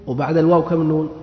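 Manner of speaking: ordinary delivery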